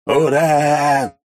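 loud, high cheer; cheering shout. A narrator's reading. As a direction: yell it in triumph. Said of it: "вопил Карло".

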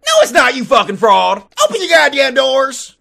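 loud, furious yell; angry shout. Retold or imitated. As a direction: bellow at the fraud behind the door. Angry caller at the door.

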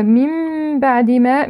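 change the ن of من into a long hummed م; nun turned to mim. Shown as a demonstration. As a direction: recite من بعد as "mim ba'd" with a held hum. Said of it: "إقلاب النون الساكنة ميما".

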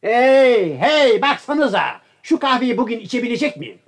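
high-pitched, impatient yelling; shouting. Acted. Guest demanding his coffee from absent servants, impatient shout. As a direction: holler toward the kitchen, fed up. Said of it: "Bağırmasanıza canım".

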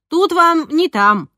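put-on slow, high, loud old-school scold; scolding cleaner. A narrator's reading. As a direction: lay down the law, drawn out. (строгая техничка, медленно)

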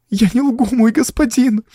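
put-on very high, tearful child's voice; sobbed. A narrator's reading. Girl to the count, sobbing. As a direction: whimper a tearful plea. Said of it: "всхлипнула Тина".

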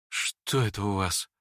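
slow, low, worried question; understated concern, drawn out slowly; uneasy. A narrator's reading. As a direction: ask it with quiet unease. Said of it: "спросил с беспокойством князь".

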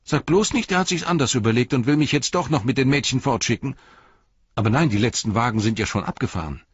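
high, fast, worried tumble of words; anxious boy. A narrator's reading. Boy fears his father will send him off, fast and high. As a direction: panic at being sent away.

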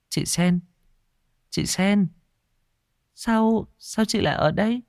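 put-on high, girlish calling voice; puzzled young girl. A narrator's reading. Hoa calling the maid in her dream, high puzzled voice. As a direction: call out uncertainly, high and girlish.

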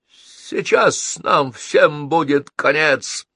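hoarse croak. A narrator's reading. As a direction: croak out the doom slowly. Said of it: "вдруг прохрипел Буги".

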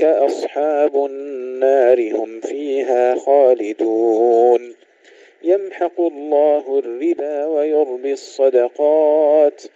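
slow, melodic chanted tarteel; Quran recitation. Acted. Reciter chanting verses of al-Baqara before the lesson, melodic tarteel. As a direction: recite each verse with long measured phrases.

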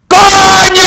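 one huge, high yell of coño; bestial coño shout. Shown as a demonstration. Streamer paying off his like goal to viewers, a huge high yell. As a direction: belt out the promised coño at full force. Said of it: "Va un coño bestial".

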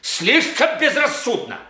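high, agitated retort; heated retort. Acted. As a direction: throw the accusation back, pitch climbing high. Acted confrontation, retorting to accuser.

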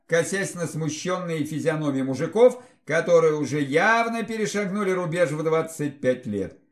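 ordinary delivery

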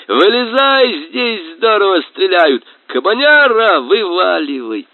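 high, loud calling to the boar. Acted. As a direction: shout down into the well.

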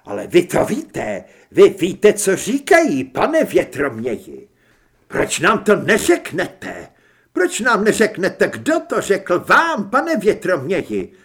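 high, loud angry interrogation; heated, emphatic questioning; shouted demands. A narrator's reading. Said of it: "vykřikl".

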